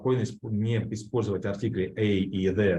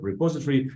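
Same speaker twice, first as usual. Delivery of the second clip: "repository" said re-POS-itory, higher pitch; stress on second syllable; 5 semitones higher, faster than usual. Shown as a demonstration. Teacher repeats after explaining stress, "repository" stressed re-POS-itory. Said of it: "ударение на второй слог".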